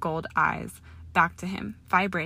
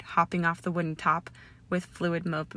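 usual speaking voice

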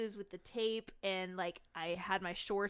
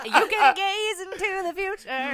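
mystic voice; 10 semitones higher, 10 dB louder, slower than usual. Pretend. Teasing about premonition.

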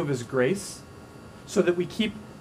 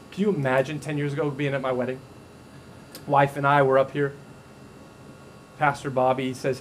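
ordinary delivery

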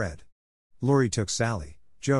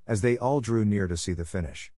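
ordinary delivery

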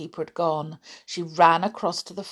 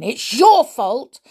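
loud, higher, slow accusation; angry shout; 6 semitones higher, 8 dB louder, slower than usual. Acted. Teddy turns fiercely on naughty doll, shouting. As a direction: throw the blame hard, leaning on YOUR. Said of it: "shouted Teddy".

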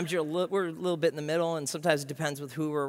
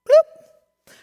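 notification blip, very high and loud; high squeaky bloop; 18 dB louder than usual. Pretend. Pastor mimicking a phone alert mid-prayer, high squeak. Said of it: "phone notification sound".

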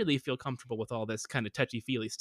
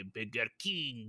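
slow, low "Burger King" in fake French; attempted French accent; 6 semitones lower, 8 dB quieter, slower than usual. Shown as a demonstration. Second try for approval, slow mock French accent. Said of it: "let me try one more time".

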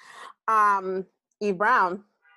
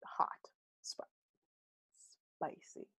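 whispered; 16 dB quieter than usual. Acted. Describing the book's steamy content.

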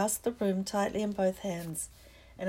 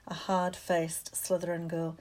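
plain reading voice

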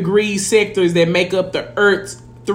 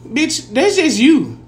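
rising, exasperated scolding at sign-blamers; exasperated callout; 7 semitones higher than usual. Real, not acted. Talking to people who blame signs, exasperated scolding. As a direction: call them out, fed up.

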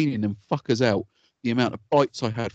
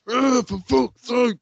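high, drawn-out mock groan of exasperation; slow, high-pitched impression of a fed-up wife; imitating exasperated wife; 13 semitones higher, 4 dB louder, much slower than usual. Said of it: "she goes".

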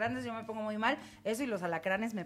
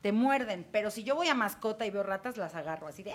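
ordinary talk, nothing marked

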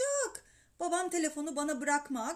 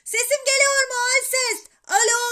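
high, full-volume yell into the phone; boy bellowing down the line; shouting; 11 semitones higher, 14 dB louder than usual. A narrator's reading. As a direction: shout back to be heard. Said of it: "Bu sefer ben bağırdım".

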